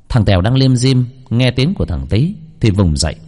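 ordinary delivery

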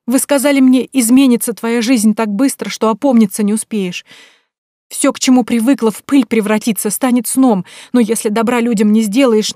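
begging her to send her back; loud, high pleading cry. Acted. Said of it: "взмолилась я".

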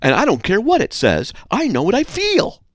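high-pitched defiant objector; hammed-up willful refusal; mock arrogance. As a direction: dismiss it stubbornly, climbing to a high finish. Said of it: "Arrogance is when you say".

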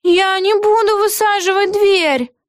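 high, drawn-out whiny protest; indignant. A narrator's reading. Aggie refusing to break down the door, high indignant voice. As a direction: refuse slowly in a very high, affronted voice. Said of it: "возмутилась Эгги Хуф".

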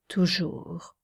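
toujours breathed in a whisper; whispered echo. A narrator's reading. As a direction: let toujours fade to a whisper. Whispered repeat. (toujours chuchoté dans un souffle)

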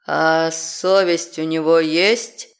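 low, slow demon voice, keenly asking; eager growl of a hellish guard; enthusiastic demon. Acted. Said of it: "С энтузиазмом поинтересовался".

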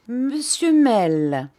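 slow, loud, drawn-out summons; vigorous voice. A narrator's reading. Headmaster shaking the assistant by the arm, forceful summons. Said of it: "avait pris assez de vigueur".

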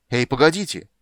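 high, loud, urgent shout; calling out. Acted. Bard calls after the riders, shouting urgently. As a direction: call after them to wait.

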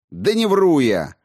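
indignant outburst; defensive shout. A narrator's reading. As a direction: shout back in denial. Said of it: "выкрикнул Соловей".

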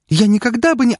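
high, loud panicked protest; breaking off mid-cry; hysterical. A narrator's reading. Continued hysterics, cut off. (истерично, высоко)

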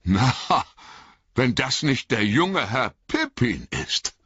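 put-on farmer recognising a young acquaintance; hearty surprised greeting; surprised exclamation. A narrator's reading. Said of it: "rief er".

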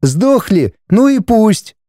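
high-pitched, callous brush-off; indignant. A narrator's reading. Wife writing off the villagers, indignant. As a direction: dismiss it impatiently. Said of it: "возмутилась Светлана".

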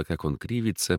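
plain reading voice

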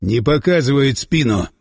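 restrained narrated yell; understated commander's shout; mild yell. A narrator's reading. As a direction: call it out firmly, without full volume. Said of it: "заорал Власов".

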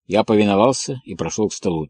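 plain reading voice

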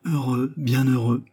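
ordinary delivery, nothing marked

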